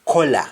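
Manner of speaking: "color" said kola, with an O vowel; Jamaican pronunciation. Shown as a demonstration. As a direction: say "color" the Patois way, as KOH-la. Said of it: "it's pronounced as".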